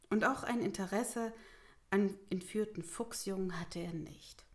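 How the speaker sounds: ordinary delivery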